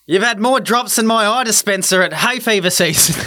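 mock on-field sledge; cheeky jab at a batter; bad acting. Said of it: "deliver it like we're out in the ground".

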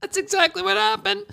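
very high, squeezed mock voice; high-pitched mocking imitation; Kamala Harris. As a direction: squeak it out as a caricature of a pleased speaker. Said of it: "Kamala Harris is like".

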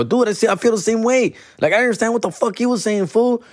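high-pitched, incredulous comeback; exasperated protest. Retold or imitated. Complaining back to cousin about confusion.

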